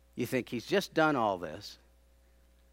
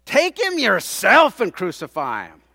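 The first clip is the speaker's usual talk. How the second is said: loud, high, exasperated outburst; disgusted Pilate; 10 semitones higher, 10 dB louder than usual. Retold or imitated. Pilate fed up with the bloodthirsty crowd, loud and high. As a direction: spit out the distaste. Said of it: "Pilate's going".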